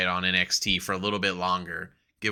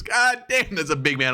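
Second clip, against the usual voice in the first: higher, amazed exclamation; mock awe; 7 semitones higher than usual. Ian seeing Oba Femi for the first time, amazed. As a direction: gasp at a huge man. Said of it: "He goes".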